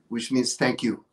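normal talking voice

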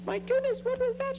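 high, loud startled shriek; shocked cry. Acted. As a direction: gasp it out in fright. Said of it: "she cried".

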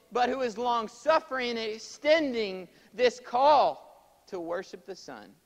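high, very loud sermon delivery; full-force preaching. Real, not acted. Preacher urging his congregation to worship the Son, impassioned full-force preaching. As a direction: proclaim it high and loud, drawing out key words.

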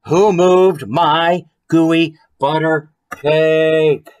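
loud, slow storybook shout. A narrator's reading. The girl finds her cake gone and shouts, loud and slow. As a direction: call it out big and slow. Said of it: "My voice started to shake as I shouted".

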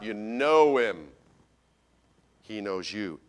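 usual speaking voice

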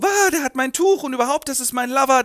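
high, loud swooning squeal. Acted. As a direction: gush it out breathlessly. Gabi excited about the stranger.

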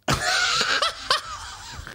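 real burst of high laughter; laughing at his own story. Real, not acted.

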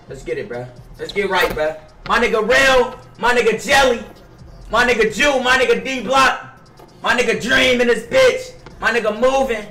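ordinary talk, nothing marked

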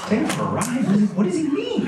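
high-pitched, baffled echo of the question; incredulous. Retold or imitated. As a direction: repeat it as if it makes no sense. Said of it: "I'm just awash in incredulity".

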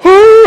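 high loud pained whoo; wincing whoo. Retold or imitated. Mother wincing as her son gets stapled, high whoo. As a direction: yelp at each staple click.

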